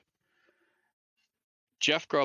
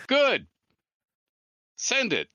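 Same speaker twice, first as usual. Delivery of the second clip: much higher and louder, eager caller; caller's voice; 6 dB louder, slower than usual. Retold or imitated. Jeff answering Ed's yes on the phone, high and eager. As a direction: snap it out high and keen. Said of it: "And he goes".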